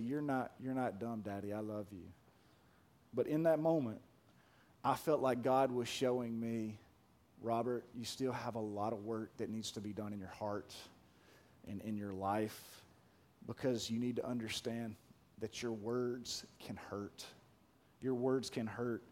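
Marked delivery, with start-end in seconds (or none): 0.0-2.1 put-on tender little girl. Daughter comforting father. 7.4-19.0 gentle divine voice. Retold or imitated. God's voice imagined, soft.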